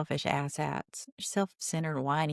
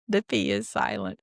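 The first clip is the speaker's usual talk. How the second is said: much lower goofy voice on the punchline; deep silly voice; 9 semitones lower than usual. Pretend. Podcaster delivering the pun's punchline, in a deep silly voice. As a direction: drop into a deep joke voice.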